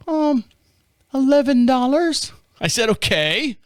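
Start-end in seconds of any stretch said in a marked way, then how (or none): 0.1-2.3 put-on high, girlish rep voice; little girl on phone. Bank rep asking caller to verify, high little-girl voice. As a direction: rattle off verification in a girlish pitch. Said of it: "I get this little girl on the phone".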